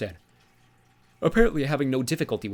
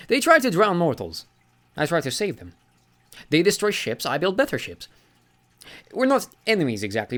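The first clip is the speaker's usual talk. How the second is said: put-on genial old sea god; 6 semitones higher, 5 dB louder than usual. Sea god corrects Magnus, jovial and higher. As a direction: lean on Granddad with amused mock offence. Njord voiced in story.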